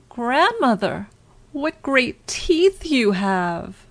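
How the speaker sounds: put-on high, slow, scared child; frightened little girl. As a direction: dread creeping into her voice.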